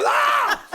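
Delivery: high laughing scream of shock; shocked shout. Retold or imitated. Two actors yelp in mock horror at the prop. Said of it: "me and Sweeney go".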